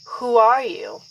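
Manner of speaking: sternly repeating who are you to the impostor; demanding question. Acted. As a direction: press the stranger for an answer. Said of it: "So I ask again".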